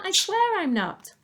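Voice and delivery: put-on high, earnest boy's voice; George swearing. Boy to Grandma on the roof, high and earnest.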